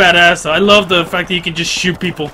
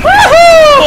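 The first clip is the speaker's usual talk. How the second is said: very high, loud "Woohoo"; whooping cheer; 7 dB louder than usual. Real, not acted. Gamer cheering his kill, high loud whoop. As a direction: whoop it out in triumph.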